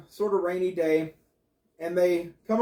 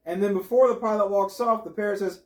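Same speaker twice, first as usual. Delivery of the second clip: ordinary talk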